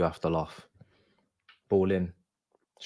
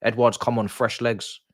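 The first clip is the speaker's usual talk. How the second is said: ordinary talk, nothing marked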